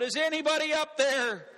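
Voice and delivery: high-pitched call for help from above; yelling out. Acted. Slipping man calls up the cliff, high-pitched yell. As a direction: mock-desperate yell up the cliff. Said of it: "he yells out".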